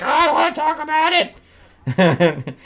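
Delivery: very high, slow comic protest; mock whiny refusal. Billy dodging her questions, mock whiny refusal. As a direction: whine it out in a put-on high voice.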